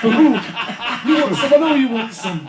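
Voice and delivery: high, loud, cackling Yorkshire tart; bawdy Yorkshire woman. Acted. Friend acts a randy Yorkshire woman chatting him up, laughing, Yorkshire accent. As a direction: leer it out in a brash Yorkshire accent.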